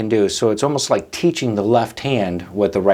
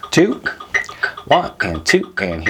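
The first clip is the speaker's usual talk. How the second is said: count-in; 4 semitones lower than usual. Shown as a demonstration. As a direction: call the band in. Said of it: "count-in before playing".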